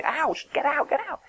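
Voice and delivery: yelling people out of a burning building; scream. Pretend. Preacher acts a warning cry at a building fire, high-pitched yell. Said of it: "You scream".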